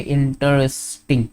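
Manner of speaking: "Interesting" said slowly and softly, part by part; broken into syllables. Shown as a demonstration. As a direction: quietly sound out "Interesting" in slow pieces. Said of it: "four syllables".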